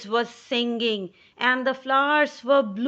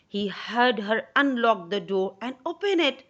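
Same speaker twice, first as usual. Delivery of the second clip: plain reading voice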